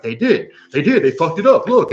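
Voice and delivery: imitating Donnie. Donnie rushing in to insist the pizza got ruined, high and loud. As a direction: play Donnie pushing the news, pitch way up and loud. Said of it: "And he's like".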